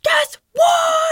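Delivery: very high, loud squeal; freak-out girl moment. Retold or imitated. Skier imitating her call to mom, squealing freak-out. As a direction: shriek it, stretching the last word. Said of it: "freak out girl moment to my mom".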